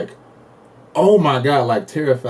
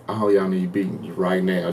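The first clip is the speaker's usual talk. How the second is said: muttered thought; 5 semitones lower, 6 dB quieter than usual. Retold or imitated. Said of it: "Just thinking that to myself".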